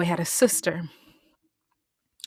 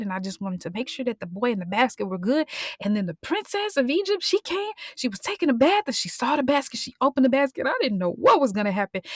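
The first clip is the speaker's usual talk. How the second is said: put-on higher, fast breathless little-girl report; excited girl; 5 semitones higher than usual. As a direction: rush the news out to mom. Girl breathlessly tells mother news.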